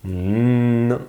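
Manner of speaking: "N" alone as a long steady hum; isolated sound. Shown as a demonstration. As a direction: hold the sound "N" on a level note. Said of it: "Quel est le son que l'on entend?".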